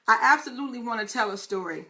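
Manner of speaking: ordinary talk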